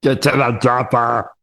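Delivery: put-on lightly done Austrian Arnie accent; a hint of Austrian action-hero bark; easy Austrian accent impression. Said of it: "put on Arnie's accent".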